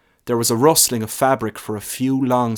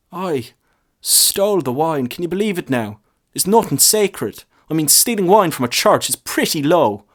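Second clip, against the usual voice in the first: lightly voiced priest, raised and scandalised; indignant priest; 7 semitones higher, 4 dB louder than usual. A narrator's reading. Priest complaining to the lad about the wine thief. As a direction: tut at the sacrilege.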